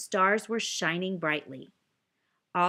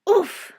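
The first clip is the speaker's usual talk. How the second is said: sharp loud oof on a hard landing; winded oof; landing grunt; 12 semitones higher, 10 dB louder than usual. Acted. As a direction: grunt as you hit the floor. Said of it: "I always have trouble with the landing".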